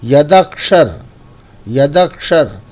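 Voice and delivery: यदक्षर as यदक, then शर; joined half consonant. Shown as a demonstration. Teacher shows where the half letter goes, split yadak-shar. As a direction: close on the क of यदक्षर, then release शर. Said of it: "यदक, उसके बाद बोलेंगे शर".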